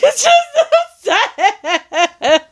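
high-pitched mock bawling; hammed-up tearful squeal with laughter in it; hysterical ugly cry. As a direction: wail it like an exaggerated sobbing fit. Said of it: "I legit hysterically ugly cried".

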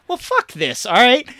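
high-pitched acted kid backtalk; hammed-up indignant outburst; mock defiance. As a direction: play a fed-up kid talking back. Defiant kid talking back to mom.

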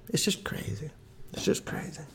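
whispered. Acted. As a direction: whisper it in disbelief. Repeated musing, whispered.

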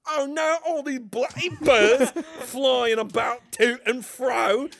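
hammed-up British movie parody, laughing a little. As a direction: complain in a comic British accent. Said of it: "Can we do my British voice again?".

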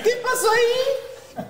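very high, slow, laughing through it; laughing squeal. Retold or imitated. Son still quoting dad to mom, laughing squeal.

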